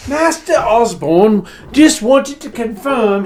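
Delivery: put-on high, loud, slow affected servant accent; put-on posh accent, over-polite servant; fancy put-on butler accent. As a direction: play a butler faking a posh accent badly. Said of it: "his accent was worse than mine".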